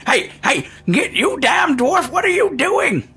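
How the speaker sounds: put-on very high, squawking old man's protest; outraged old man. Old Tim yells at dwarf invading booth, outraged high voice. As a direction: shriek slowly at the intruder as old Tim. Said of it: "and Tim's going".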